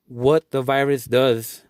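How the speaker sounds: ordinary talk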